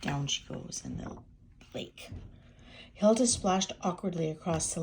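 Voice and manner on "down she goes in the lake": whispering. A narrator's reading. Hushed aside.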